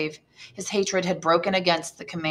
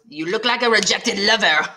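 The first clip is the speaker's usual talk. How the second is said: coarse jest; 3 semitones higher, 5 dB louder than usual. Acted. Caderousse jesting coarsely.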